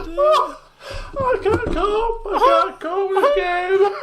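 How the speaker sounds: high, slow half-laughing, half-crying; laughing to tears. Real, not acted. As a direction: crack up despairingly. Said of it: "I'm laughing, but I feel like crying".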